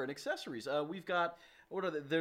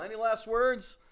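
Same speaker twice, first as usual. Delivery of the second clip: louder, raised ominous question; 4 semitones higher, 6 dB louder than usual. Real, not acted. Host asking the guest for final words, unintentionally ominous. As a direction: ask it like a last request. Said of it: "That sounded really ominous".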